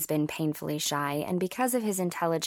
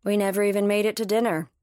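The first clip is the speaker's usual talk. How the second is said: slightly higher, lightly pleased admission; unbothered; 4 semitones higher, 4 dB louder than usual. A narrator's reading. Cam tells Lily about the failed date, unbothered. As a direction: report it as no bad thing. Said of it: "sounding like that wasn't a bad thing".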